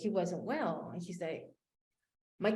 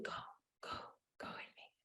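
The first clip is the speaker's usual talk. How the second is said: urgent unvoiced whisper; the inner voice pushing her to go, whispered; 20 dB quieter than usual. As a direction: hiss it like a nudge from inside. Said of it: "something again inside of me said".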